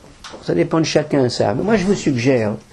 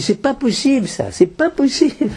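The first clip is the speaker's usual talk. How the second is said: indignant cry; 10 semitones higher than usual. Retold or imitated. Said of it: "Il a crié".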